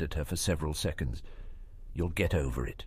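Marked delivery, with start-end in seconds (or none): 1.9-2.8 cold finality. Acted. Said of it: "with cold finality".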